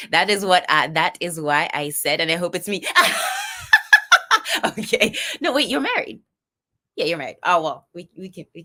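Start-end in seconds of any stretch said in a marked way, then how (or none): 2.9-4.6 high, loud burst of laughter; real laugh shooting high and loud; big laugh. Real, not acted. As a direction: crack up at the joke. Laughing at married viewer.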